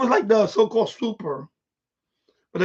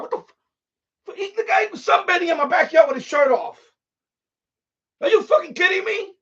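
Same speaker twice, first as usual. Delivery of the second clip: outraged yell; 8 semitones higher than usual. Real, not acted. Indignant rant about the sunbathing tenant.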